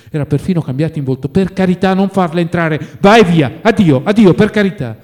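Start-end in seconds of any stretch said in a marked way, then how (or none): 1.3-5.0 high, loud panicked begging; alarmed cry for mercy; frightened pleading. A narrator's reading. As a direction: beg him desperately to go away. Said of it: "Sfuggì d'un tratto Oblomov spaventato".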